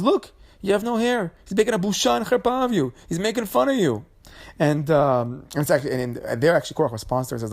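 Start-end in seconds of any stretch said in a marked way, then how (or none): 0.0-4.1 much higher, agitated complaining voice as Korach's wife; a wife stirring up her husband, voiced high and pushy; mock whiny wife.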